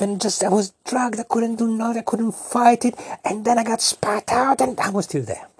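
high, breathless storytelling rush; child thrilled by his own wild tale, high and eager; breathless excitement. Acted. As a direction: ride the tumble up high and keen. Being sucked into quicksand.

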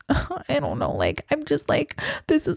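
soft, hesitant imitation of an upset girl; mock upset contestant. Upset girl telling the Bachelor it's really hard, mock-fragile. Imagined contestant struggling with her feelings.

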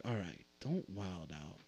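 soft, low, slow self-talk; muttered calm-down to self; inner self-calming. Retold or imitated. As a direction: quietly tell yourself to stay calm. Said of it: "I'm like".

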